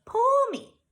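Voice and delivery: high, loud tempting little voice; squeaky temptress pigtail. A narrator's reading. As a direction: coax him like a tiny imp. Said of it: "said the pigtail".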